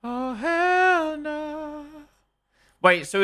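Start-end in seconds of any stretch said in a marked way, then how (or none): 0.0-1.8 high, very slow drawn-out refusal; mock horrified. Streamer hearing boogeyman story, hammed-up horrified wail. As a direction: stretch it out like a hammed-up scaredy cat.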